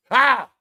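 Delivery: sudden loud, high "Ha!"; startling "Ha!". Shown as a demonstration. Showing viewers how easily they jump, sudden startling shout. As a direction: bark out a quick "Ha!" to startle.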